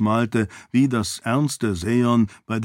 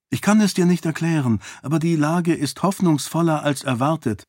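higher, earnest heroine's voice; princess reassuring father; 6 semitones higher, faster than usual. Acted. Daughter tells father things are hopeful, higher earnest voice. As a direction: insist gently, pitched up.

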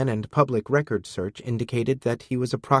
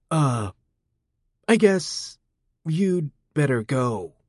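nervous backing off; 4 semitones higher, slower than usual. A narrator's reading. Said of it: "He stepped away from her".

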